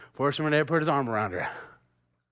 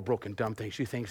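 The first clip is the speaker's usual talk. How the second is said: usual speaking voice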